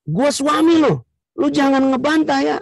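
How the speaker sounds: husband ordering his wife not to argue back; bossy husband. Acted. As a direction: lay down the law to her. Husband asserting authority.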